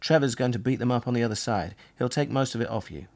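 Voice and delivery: plain reading voice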